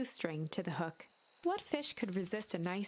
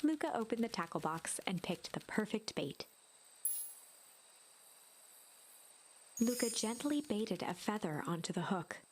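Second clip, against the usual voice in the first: whispered narration. Acted. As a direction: whisper the narration like a bedtime story. Narrator reads the game text.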